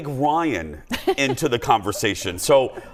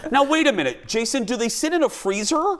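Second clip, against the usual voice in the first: curious Meg; 10 semitones higher, faster than usual. Retold or imitated. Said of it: "She goes".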